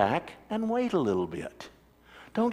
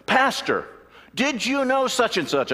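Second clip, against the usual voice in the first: high, eager rushing questions; lightly hammed-up eager churchgoer; excited congregant; 9 semitones higher, 3 dB louder than usual. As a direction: burst in all fired up. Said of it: "came wandering in all excited".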